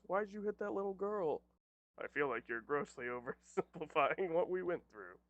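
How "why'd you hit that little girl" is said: high-pitched mock reporter question; press reporter. Press questioning the hero, high mock-reporter voice. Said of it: "Just me with the press".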